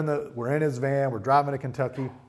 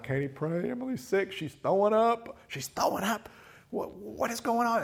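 higher, urgent, panicky; frantic call; 10 semitones higher than usual. Retold or imitated. A dad calling his wife in a panic. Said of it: "I'm like".

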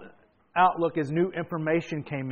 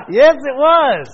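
loud, high mock agreement; preacher hamming up his own eager answer; hammed-up yes; 11 semitones higher, 17 dB louder, much slower than usual. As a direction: blurt out the yes, overplayed. Preacher mimics his own reply.

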